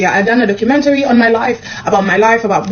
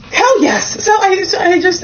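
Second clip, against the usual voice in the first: thrilled; 10 semitones higher than usual. Real, not acted. Thrilled at holiday invite.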